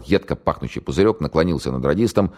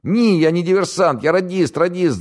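put-on much higher, louder panicky young man; scared radio operator; 4 dB louder than usual. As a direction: blurt it out in terror. Голос перепуганного радиста. (испуганный пленный радист, высоко и громко)

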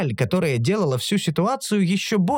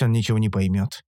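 ordinary delivery

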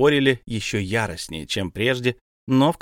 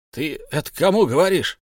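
higher, louder shout; a sharp challenging cry; 6 semitones higher, 4 dB louder than usual. A narrator's reading. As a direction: William yelling back at the others. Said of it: "крикнул Вильям".

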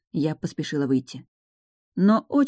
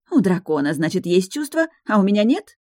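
higher and louder, bitter question; indignant; 4 semitones higher, 4 dB louder than usual. Acted. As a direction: demand it, hurt and angry. Fiancée quarrels.

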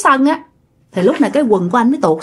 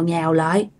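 usual speaking voice

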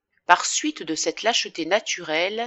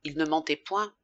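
usual speaking voice

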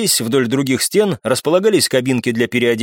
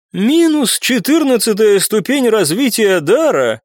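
higher, slow, disbelieving; woman clerk voice; 8 semitones higher, slower than usual. Acted. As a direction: read it out slowly, higher. Clerk reads out result.

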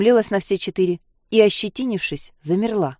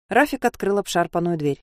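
plain reading voice

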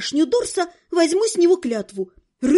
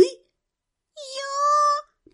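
tiny high squeak of a small creature; squeaky toy voice; 12 semitones higher than usual. A narrator's reading. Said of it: "пропищал комок".

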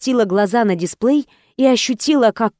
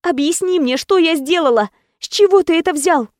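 higher, pleading and upset; a desperate girlfriend, higher; heroine desperate; 7 semitones higher than usual. Acted. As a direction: demand an answer through despair.

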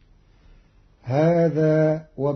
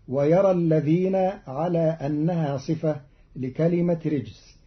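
usual speaking voice

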